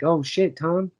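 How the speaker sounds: high, rising mock alarm; mock urgent plea. Viewer pleading with the movie's hero, mock urgency. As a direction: urge him on in a squeaky panic. Acting out a viewer urging the character.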